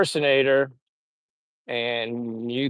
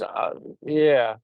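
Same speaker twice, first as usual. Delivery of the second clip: usual speaking voice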